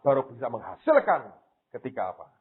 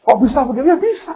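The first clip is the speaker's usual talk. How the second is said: high, loud burst of disbelief; retold outburst; 13 semitones higher, 11 dB louder than usual. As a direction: cry it out in a much higher, louder voice. Retold outburst over thrown-away money.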